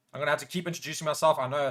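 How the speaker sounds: normal talking voice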